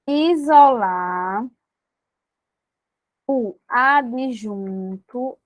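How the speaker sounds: very slow, spaced-out dictation of the correction. Shown as a demonstration. Teacher dictating a correction, slowly. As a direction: spell it out word by word for the students.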